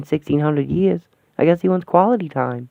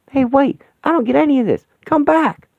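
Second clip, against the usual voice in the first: high, loud call after someone leaving; desperate call to stop someone; 12 semitones higher, 5 dB louder than usual. Acted. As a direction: shout after him to come back. Calling after departing father.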